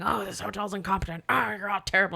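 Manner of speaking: mock angry caller, no real volume; blurred, hammed-up complaint; imitated yelling. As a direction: mimic a ranting hotel guest. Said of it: "like, yell and yell".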